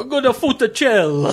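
hammy mock Italian dish name; hammed-up fake Italian. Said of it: "fake, uh, Italian dish you made up".

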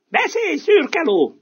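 loud, high and slow; urging rooster. Acted. Rooster urging the donkey at the window, loud. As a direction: press the donkey to tell. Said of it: "Nógatta a kakas".

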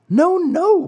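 acted bitter sobbing. Puppet denies theft to the farmer, high tearful wail. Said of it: "sobbing bitterly".

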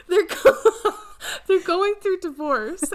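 high, loud, breaking into laughter; can barely get the words out for laughing; laughing through words. Real, not acted. Telling her friend about divorce TikToks, laughing through the words.

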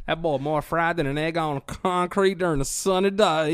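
loud mock hillbilly voice; Southern saying. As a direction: spin a folksy Southern simile.

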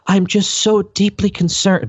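high, prissy mock of a leftist senator. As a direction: lift it up high and sound theatrically concerned. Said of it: "That's my leftist voice".

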